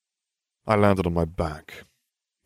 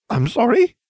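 high, loud startled squeak; 8 dB louder than usual. Acted. Hermione squeaks in shock as Ginny appears, high and startled. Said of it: "Hermione squeaked".

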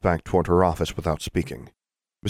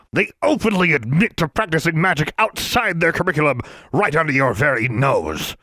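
pompous bellowing official; 8 semitones higher than usual. Acted. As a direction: scold like an outraged bureaucrat. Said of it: "Mister Brown's bellowing".

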